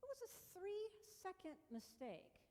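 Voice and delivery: soft, high reassuring voice; speaking kindly to herself after the mistake; self-compassion. Retold or imitated. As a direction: gently talk yourself down. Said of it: "I said".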